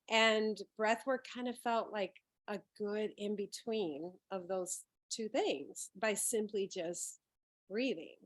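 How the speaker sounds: ordinary talk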